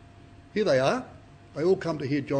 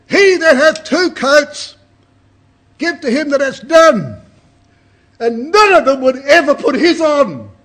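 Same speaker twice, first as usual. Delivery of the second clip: loud, high, slow booming call; prophet's shout; 8 semitones higher, 12 dB louder, slower than usual. Acted. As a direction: proclaim it to the whole crowd from the heights. John answers the crowd.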